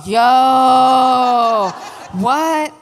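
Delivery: loud Brooklyn New York yell. Retold or imitated. Tiffany reacting to the news, Brooklyn New York shout. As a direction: bark it out Brooklyn style. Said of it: "'cause she's Brooklyn, New York".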